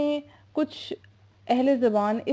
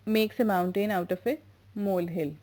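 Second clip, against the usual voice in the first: slow dictation; 3 semitones lower, much slower than usual. Shown as a demonstration. Teacher repeats the idiom.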